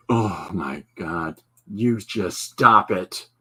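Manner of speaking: slow, drawn-out melancholic comedian voice; Stephen Wright. Acted. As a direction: drag it out in mock melancholy. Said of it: "talks really melancholic".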